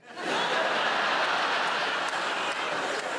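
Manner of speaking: breathy burst of laughter; high laugh. Real, not acted. Comic laughing at his mum's put-down. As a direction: laugh hard at the line.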